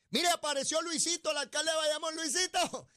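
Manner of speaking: mock announcement. Mocking announcement of hiding mayor.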